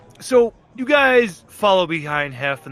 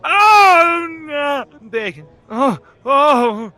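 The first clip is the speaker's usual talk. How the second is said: loud high mock moans; moaning from the bath; 12 semitones higher, 8 dB louder, much slower than usual. Overheard bathers getting it on, loud mock moaning. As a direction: ham up overheard passionate cries. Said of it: "and you just hear".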